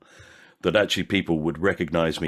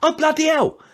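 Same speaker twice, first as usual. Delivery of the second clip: much higher and louder gushing, tumbling out; high, loud, breathless fan gushing over the actor; overexcited fan; 5 dB louder than usual. Retold or imitated. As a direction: play a starstruck fan who can't contain himself. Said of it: "they go".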